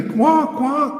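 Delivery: high mocking quacks like a duck. Mocking followers as ducks.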